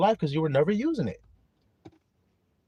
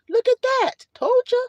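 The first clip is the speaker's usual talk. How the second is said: gleeful high gloat; 4 dB louder than usual. Real, not acted. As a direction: squeal it much higher, triumphant. Gloating over snatched promise.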